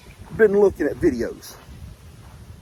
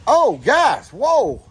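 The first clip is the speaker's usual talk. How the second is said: surprised; 9 semitones higher, 7 dB louder, much slower than usual. Real, not acted. Sudden surprise at the news.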